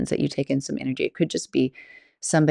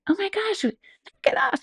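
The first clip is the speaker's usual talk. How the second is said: much higher, half-joking startled cry; mock startled shriek. Telling off a friend she thinks pranked her, high mock shriek. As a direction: squeal it like a playful scare. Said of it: "but more joking".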